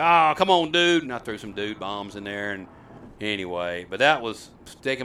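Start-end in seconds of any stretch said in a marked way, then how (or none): 0.0-1.0 loud, high, hearty buddy greeting; overeager cheer. Retold or imitated. Host covering his mistake with the boy, overeager cheer. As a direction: burst out louder, hyping up the kid.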